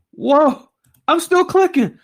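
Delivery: high amazed whoa; thrilled, marvelling at the count; excited. Real, not acted. Amazed at endless names.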